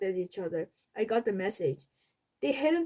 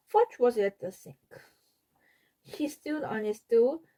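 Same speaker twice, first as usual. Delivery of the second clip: usual speaking voice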